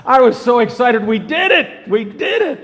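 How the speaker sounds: high and loud, bursting with joy; very excited. Retold or imitated. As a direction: shout it out like you can't contain it. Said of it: "'cause I can get very excited".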